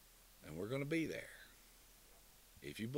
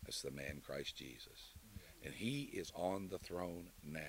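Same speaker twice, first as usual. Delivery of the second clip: usual speaking voice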